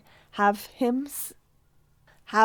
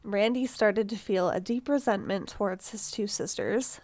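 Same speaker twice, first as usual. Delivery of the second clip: ordinary delivery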